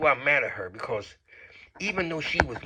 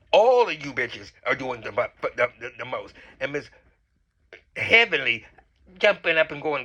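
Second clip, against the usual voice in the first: ordinary talk, nothing marked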